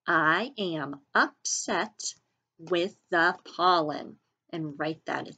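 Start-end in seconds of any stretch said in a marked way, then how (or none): 0.0-4.2 very slow, one word at a time; slow choral model. Shown as a demonstration. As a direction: lead the class through it slowly. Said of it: "Say that with me".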